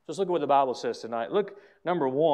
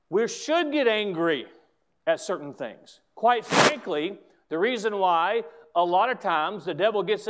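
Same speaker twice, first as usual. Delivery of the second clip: loud, emphatic pulpit delivery; forceful preaching; 7 dB louder, slower than usual. Real, not acted. Pastor telling congregation they should get angry, preaching forcefully. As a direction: drive each phrase home from the pulpit.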